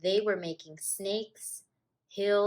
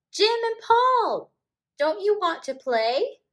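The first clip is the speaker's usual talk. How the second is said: calling out; 12 semitones higher, 9 dB louder than usual. A narrator's reading. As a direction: call the boys over from across the room. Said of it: "Anna Maria called".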